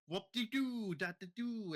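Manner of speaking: high, loud goofy singsong; playful nonsense. Retold or imitated. Kid having fun recording random words, playful and high. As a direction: blurt silly sounds at a high pitch.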